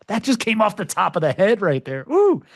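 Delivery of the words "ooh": high, loud pleased ooh; a delighted squeal of praise for himself; proud little whoop; excited ooh. Real, not acted. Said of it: "I'm cooking, baby".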